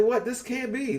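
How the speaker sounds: reliving the moment the news hit; stunned disbelief at the news of a death; shocked disbelief. Retold or imitated. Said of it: "I was like".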